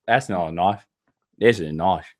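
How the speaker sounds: low-pitched Australian accent impression; Crocodile Dundee. Host warming up his Australian accent for a skit, low Australian impression. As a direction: do a deep, broad Australian film-hero line. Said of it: "I gotta do Crocodile Dundee".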